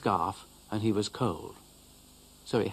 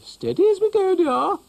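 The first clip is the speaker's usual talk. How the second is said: much higher and louder, sing-song matron; motherly cow soothing; 10 dB louder than usual. Acted. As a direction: cow calms the upset dog, dear. Ermintrude the cow speaks.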